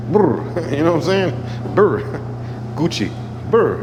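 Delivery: high shivering brr, laughing; playful brr shiver. Pretend. Shivering joke about cold.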